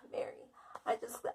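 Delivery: breathless whisper to a friend on the phone; whispered plea. Retold or imitated. Calling friend Mary after cutting her leg, whispering. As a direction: hush it, scared to be overheard.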